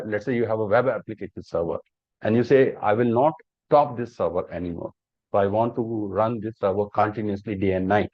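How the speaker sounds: ordinary talk, nothing marked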